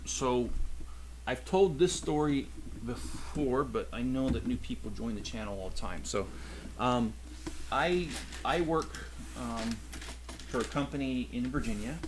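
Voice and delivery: normal talking voice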